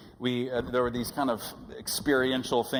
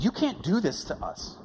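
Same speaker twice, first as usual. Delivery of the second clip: higher mock yell at the kid; half-hearted replay of an angry outburst; retold yelling; 6 semitones higher than usual. Preacher retelling yelling at a kid who quit, mild mock yell. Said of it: "Started yelling".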